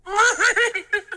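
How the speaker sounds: high squeaky giggle, fading; a tiny creature's tickled giggle; Yoda giggle. Acted.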